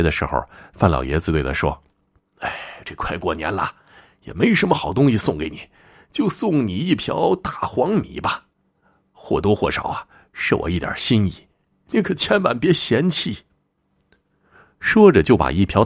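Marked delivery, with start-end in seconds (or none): 2.4-13.4 put-on kindly old host, modest. Old man sees off his guest with millet, modest elderly voice. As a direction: offer a humble gift as an old man.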